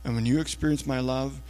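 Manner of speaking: soft, low, unhurried reassurance; gentle Jesus. Acted. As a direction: speak tenderly, pausing between each promise. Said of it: "what we have is Jesus saying".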